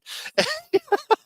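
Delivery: squealing high laugh; real laugh at own joke. Real, not acted.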